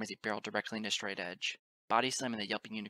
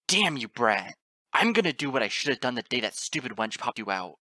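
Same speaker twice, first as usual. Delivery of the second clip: loud, raging father; enraged threat; 11 dB louder than usual. A narrator's reading. Father lifting colt by the neck, loud rage. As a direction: rage at him at full volume. Father attacking his son.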